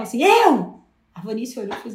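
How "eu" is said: Eu! yelled high and loud. Retold or imitated. Volunteering at the live event, a loud high yell. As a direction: shout it out to grab the mic. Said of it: "E eu dei um berro".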